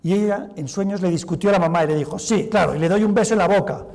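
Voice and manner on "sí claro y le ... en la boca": loud, raised sarcastic snap back; mock the idea, high and loud; sarcastic retort. Daughter sarcastically arguing with her dead mother, loud and high.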